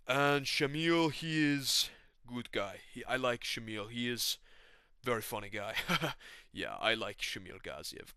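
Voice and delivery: slow, put-on foreign accent; measured non-native accent with broken grammar; Yuri. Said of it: "Yuri's not laughing at all".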